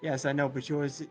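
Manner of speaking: ordinary delivery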